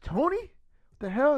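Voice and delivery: high, drawn-out stunned question; mock disbelief. Imagining the OGs spotting Tony as Doom, mock disbelief. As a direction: draw the name out high, as if baffled.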